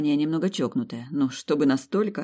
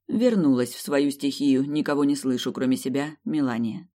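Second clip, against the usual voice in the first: ordinary delivery, nothing marked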